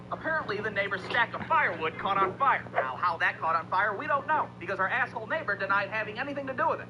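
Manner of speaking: ordinary talk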